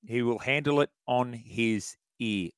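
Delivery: ordinary talk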